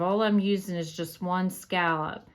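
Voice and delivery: usual speaking voice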